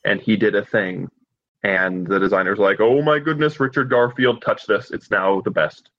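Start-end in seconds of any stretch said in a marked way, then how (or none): none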